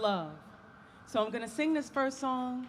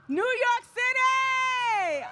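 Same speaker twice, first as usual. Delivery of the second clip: high, loud crowd greeting; crowd greeting shout; 12 semitones higher, 6 dB louder than usual. Real, not acted. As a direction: belt it out to the crowd. Singer greets crowd on stage.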